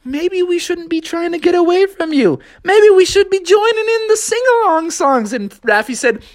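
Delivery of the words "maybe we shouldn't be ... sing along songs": high, eager frog. Acted. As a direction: won over, joining in.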